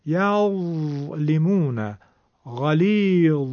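یظلمون and غلیظ slow, ظ rough at the tongue tip; rough ظ demo. Shown as a demonstration. Teacher models the tongue-tip ظ in examples. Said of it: "زبر از نوک زبون تلفظ".

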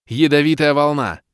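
high, loud spell call; spell shout. A narrator's reading. Ros casting from the scroll, loud spell cry.

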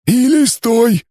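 high, gurgling alien voice; Issu gurgling. Acted. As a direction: bubble the words like a water creature. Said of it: "понятливо пробулькал Иссу". (булькающий голос пришельца)

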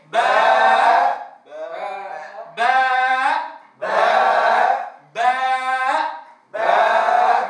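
slow, loud باء with the alif drawn out and mouth open; teacher leading a loud drawn-out باء; full long baa. Shown as a demonstration. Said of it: "يلا معي".

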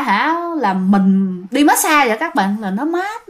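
ordinary delivery, nothing marked

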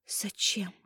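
very soft question with a sob; tearful whisper. A narrator's reading. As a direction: barely breathe it out. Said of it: "прошептала Дарья, всхлипнув".

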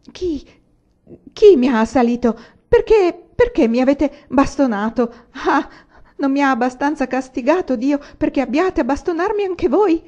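loud, high, anguished outcry; distraught. A narrator's reading. Efes after his fit to those around him, distraught. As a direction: cry out in terror and reproach. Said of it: "E poi si mise a piangere".

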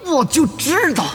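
high, slow "I knew it"; high, drawn-out rueful remark; rueful knowing. Acted. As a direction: sigh it out as if you saw it coming. Resigned after the player leaves.

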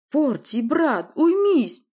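loud, high plea to his brother; pleading call. A narrator's reading. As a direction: call out, begging him to stop. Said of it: "попросил Володя".

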